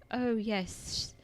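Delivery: hammed-up British movie prince; British-accented royal announcement, lightly mocking. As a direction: put on an American's fake British accent. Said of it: "the dude has a British accent".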